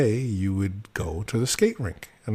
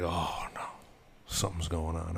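hushed, anxious whisper; worried whisper; 4 semitones lower, 10 dB quieter than usual. Retold or imitated. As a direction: whisper it in alarm. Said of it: "I'm like".

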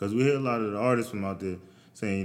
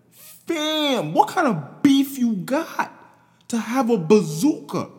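much higher, louder, slow disbelieving squeal; high, loud disbelieving rant; incredulous shriek; 8 dB louder, slower than usual. Real, not acted. Disbelief at needing a bazooka.

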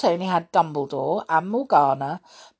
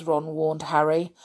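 plain reading voice

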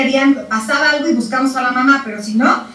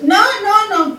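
much higher sharp no, no, no; alarmed parent no; 7 semitones higher, much slower than usual. Retold or imitated. Parent stopping a toddler touching the socket. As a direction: stop the child, a touch of panic.

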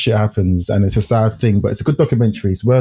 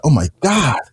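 higher, put-on enthusiastic voice; 3 semitones higher than usual. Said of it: "he gives him an example".

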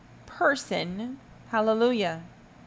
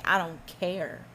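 1 mock supporter; 10 dB louder, slower than usual. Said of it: "and you say".

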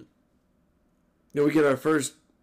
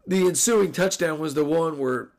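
usual speaking voice

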